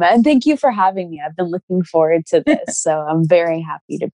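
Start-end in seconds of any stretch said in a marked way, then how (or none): none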